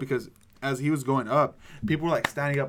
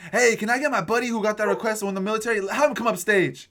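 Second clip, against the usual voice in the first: calling out; 7 semitones higher, 5 dB louder than usual. Retold or imitated. Retelling guy's call to stage.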